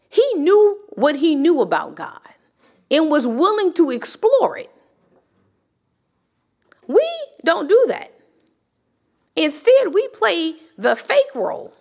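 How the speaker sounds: ordinary talk, nothing marked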